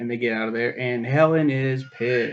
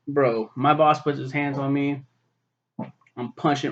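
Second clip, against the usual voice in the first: ordinary delivery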